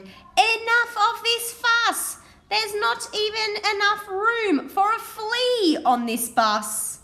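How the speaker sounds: shouting passenger who has had enough; fed-up shout. Acted. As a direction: yell at the whole crowded bus. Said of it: "somebody shouted".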